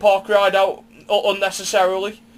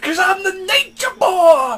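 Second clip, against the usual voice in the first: much higher, louder, slow shouted boast; Ric Flair catchphrase; 7 dB louder than usual. Retold or imitated. Host shouting Flair's catchphrase after the story. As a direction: yell it like the Nature Boy.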